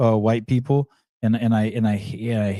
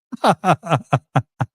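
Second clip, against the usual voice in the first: loud laugh; 7 semitones higher, 4 dB louder than usual. Real, not acted. Laughing at accent clip.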